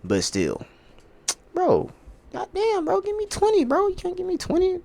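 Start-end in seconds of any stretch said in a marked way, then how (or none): 1.5-4.8 very high squeezed whine; fed-up begging, pitched way up; exasperated pleading. Real, not acted. Pleading over lost bet.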